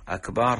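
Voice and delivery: أكبر pronounced "akbaar"; stretched ba. Shown as a demonstration. Sheikh saying this word means a drum, stretched ba. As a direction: draw the b of أكبر into a long aa. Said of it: "مد الباء في أكبر".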